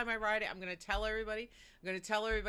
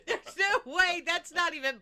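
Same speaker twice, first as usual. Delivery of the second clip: laughing; 7 semitones higher, 7 dB louder than usual. Real, not acted. Laughing at absurd ride goal.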